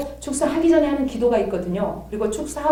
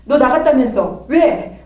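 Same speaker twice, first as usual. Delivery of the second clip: slow, louder accusing question; stern challenge; 3 semitones higher, 7 dB louder, slower than usual. Retold or imitated. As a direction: confront the hiding spirit. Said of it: "막 불을 집어넣으면".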